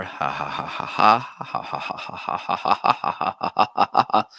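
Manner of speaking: staged rhythmic ha-ha laugh; long run of forced ha-has. Shown as a demonstration. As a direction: fake a long laugh to test the mic. Test laughs into microphone.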